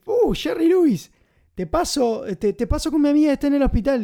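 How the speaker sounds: pushy fan lady. Retold or imitated. Woman on a payphone begging Jerry Lewis, high and pleading. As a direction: gush at the star in a high voice.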